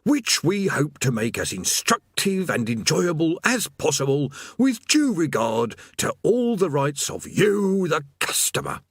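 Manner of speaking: high, emphatic scripted demon patter; forced corporate recital, word by word; spitting out words. A narrator's reading. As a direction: spit out every word, stretching the last phrase. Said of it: "spitting out each word".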